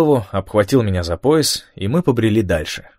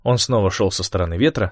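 ordinary delivery

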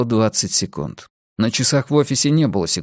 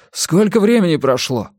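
higher, urgent question; anxious raised voice; panicked; 7 semitones higher than usual. A narrator's reading. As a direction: demand it in alarm. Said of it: "Паника всерьёз ими овладела".

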